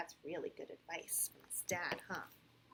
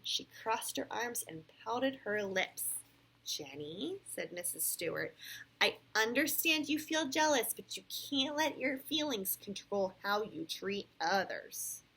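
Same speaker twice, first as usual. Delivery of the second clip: clear, animated read-aloud; storybook voice with lively rises and falls; picture-book narration; 6 semitones higher, 11 dB louder, slower than usual. A narrator's reading. Parent reads Sam's story to a child, animated storytelling.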